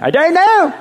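laughing, high, loud mock British accent on "I don't know". Shown as a demonstration. Pastor jokes his stock counseling answer in British accent. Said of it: "I've even got a British accent".

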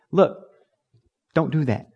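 normal talking voice

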